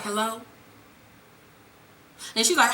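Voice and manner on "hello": low, soft phone hello; composed. Retold or imitated. Tasha answering the phone after crying, composed. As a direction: answer quietly, keeping it together. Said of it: "So she gathered herself together".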